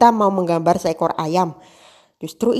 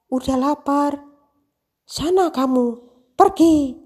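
sinetron drama voice; 8 semitones higher, slower than usual. Retold or imitated. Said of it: "dia ngomong gini ya".

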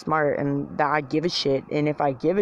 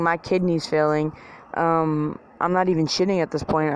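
ordinary delivery

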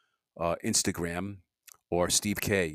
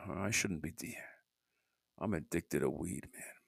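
hushed, slow whisper; low secret whisper; whispered confession; 5 semitones lower, 10 dB quieter than usual. Real, not acted. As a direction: let it slip out under your breath. Confessing under his breath.

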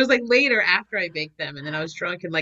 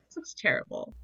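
whispered aside; 9 dB quieter than usual. Retold or imitated. Muttered reaction to clips.

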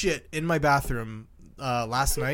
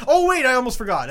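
mock sudden remembering, higher and louder; sudden realization; 7 semitones higher, 7 dB louder than usual. Owner voicing his dog on the walk home, mock sudden realization. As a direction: act out a dog that almost forgot. Acts out forgetful dog mid-rant.

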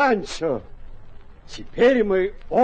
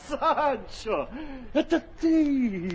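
higher, laughing call; joyful laughing greeting; 6 semitones higher, slower than usual. Acted. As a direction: laugh with delight at the reunion. Don Quixote joyful recognition. (радостный смех узнавания)

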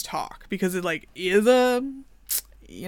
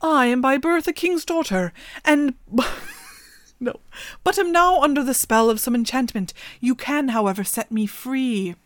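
higher and louder, a touch grand; raven princess voice; 5 semitones higher, 4 dB louder than usual. Acted. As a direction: try a new voice for the enchanted raven. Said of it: "maybe she talks like this".

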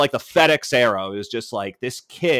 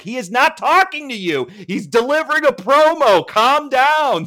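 exasperated scolding; 8 semitones higher, 5 dB louder than usual. Retold or imitated. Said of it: "I'm like".

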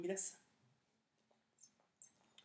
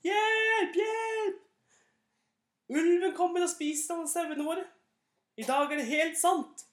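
very high, loud fake alarm; louder shout for help; 11 semitones higher, 6 dB louder, slower than usual. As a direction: scream it even higher to fool them again. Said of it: "ropte enda høyere enn forrige". (enda høyere falskt rop om hjelp)

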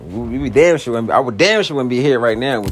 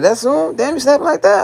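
much higher, a bit louder, hammed-up disbelief; mock outrage at how fast they fight; 13 semitones higher, 4 dB louder than usual. Imitating a friend shocked at the fighting, hammed-up. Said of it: "You like".

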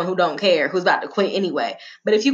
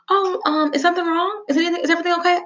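nice cashier impression; 10 semitones higher, faster than usual.

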